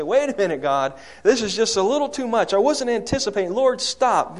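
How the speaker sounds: loud, high-pitched objection to God; alarmed protest. Acted. Christians hit by wind and waves, acted alarmed protest. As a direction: protest as if things got out of hand. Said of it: "we step back and say".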